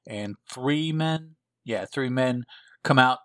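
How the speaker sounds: normal talking voice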